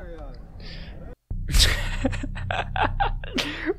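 long, high, breathless laughing fit; real helpless laughter. Real, not acted. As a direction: burst out laughing till it sounds like crying. Cracks up at the game.

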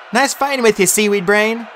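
high-pitched fond goodbye; farewell banter. A narrator's reading. Farewell between friends before charging.

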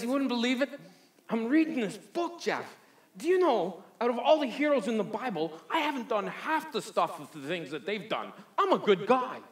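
high, loud, badly faked Newfie accent; Eli in mock Newfie. Parishioner comparing himself to Bible heroes, exaggerated mock Newfie accent. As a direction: ham up a lilting Newfie accent, insistent. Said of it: "sounds better with an accent".

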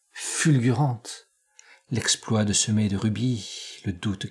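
measured poetry reading with long pauses; hushed, lingering close on each verse; poem recitation. Acted. Recites Mallarmé's sonnet and prose lead-in.

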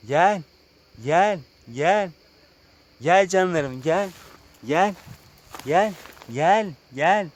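high, repeated coaxing call; calling animals. Real, not acted. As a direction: coax animals closer, word by word.

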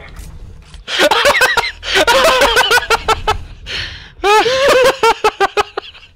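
long, high helpless cackle; laughing hard. Real, not acted. Player cracking up at friend's sarcasm, hard laughter. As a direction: crack up completely, in bursts.

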